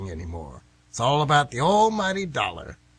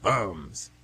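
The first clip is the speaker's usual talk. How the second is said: mock scorn; 8 semitones lower than usual. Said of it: "that's what they called them".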